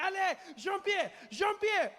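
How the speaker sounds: high, hammed-up French panic with stretched endings; panicked Frenchman. French father fleeing a snake, mock panicked French shout. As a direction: cry out as a mock-terrified French dad. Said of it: "The French guy was my favorite".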